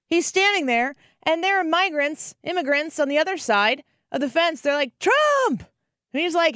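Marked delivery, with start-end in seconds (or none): 5.0-5.6 high-pitched excited shout; imitated crowd yell, pitched way up; crowd calling out. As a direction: shout it like a fan hailing someone across a fence. Crowd calling to Trump at fence.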